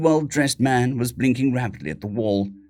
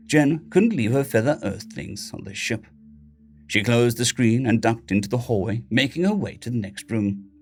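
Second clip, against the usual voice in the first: ordinary delivery, nothing marked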